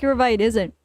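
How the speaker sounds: ordinary talk, nothing marked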